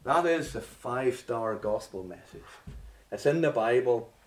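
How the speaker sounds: normal talking voice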